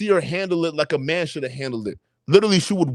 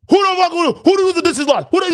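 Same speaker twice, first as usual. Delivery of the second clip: mock angry mother, much higher and louder; imitating mom screaming; 12 semitones higher, 4 dB louder than usual. Mom storming home over badly washed dishes, mock screaming. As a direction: ham up an irate mom yelling at her kid. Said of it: "You will hear her screaming".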